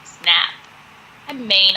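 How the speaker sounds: normal talking voice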